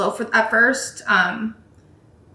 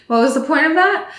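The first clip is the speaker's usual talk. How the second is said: mock baffled question, a bit higher; baffled team; 5 semitones higher than usual. Team on the radio asking why, mildly baffled mock voice. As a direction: play the confused team, lightly puzzled. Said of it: "they're like".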